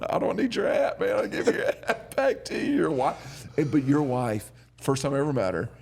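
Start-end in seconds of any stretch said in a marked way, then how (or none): 0.1-2.7 high, laughing protest; laughing while giving back the hat; laughing through it. Retold or imitated. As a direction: giggle out the refusal.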